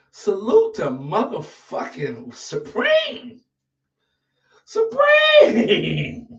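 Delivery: drawn-out announcer hype, then a high squeal; big drawn-out shout-out. Acted. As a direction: stretch the name like a ring announcer. Hyped shout-out to Supreme.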